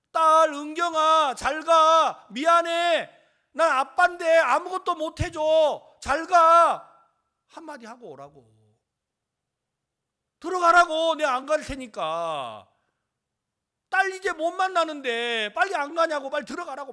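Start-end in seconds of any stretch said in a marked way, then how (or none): 0.1-6.9 high, very loud drawn-out cry; anguished farewell. Acted. Father bids his dying daughter goodbye, anguished shouting. 10.4-16.9 high, very loud insistence; urgent shouting. Acted. Relative pushes him to go in before it's too late, urgent shouting. Acted urging to enter the ward.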